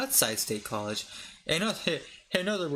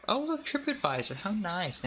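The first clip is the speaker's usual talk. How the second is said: high mock cheer, then flat; sarcastic; 6 semitones higher than usual. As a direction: fake delight at a website. Mock delight at review site.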